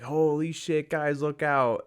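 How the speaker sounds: high-pitched, drawn-out cry of alarm; alarmed warning. Acted. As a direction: warn friends of oncoming danger. Acted warning of truck hitting train.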